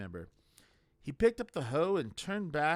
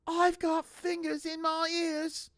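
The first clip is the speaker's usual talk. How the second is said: put-on much higher, slow, childlike insistence; stubborn novice; 7 dB louder, slower than usual. A narrator's reading. Brutha tells the voice, high and stubborn. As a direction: plug your ears and declare it.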